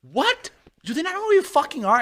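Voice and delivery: imitating a fan kid outraged he can't get in; overexcited fan kid. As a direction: play a kid outraged on your behalf.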